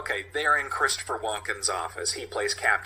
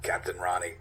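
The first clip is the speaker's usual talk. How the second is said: ordinary talk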